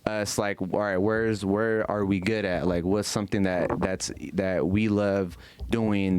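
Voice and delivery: normal talking voice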